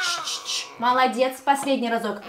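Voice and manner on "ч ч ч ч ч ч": soft long shush. Shown as a demonstration. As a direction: hush them gently. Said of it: "Тихо, как мышка".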